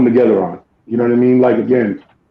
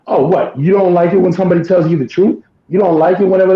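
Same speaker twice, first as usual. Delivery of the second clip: higher, snippy sass; mock sassy retort; 6 semitones higher than usual. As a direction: throw it back with attitude.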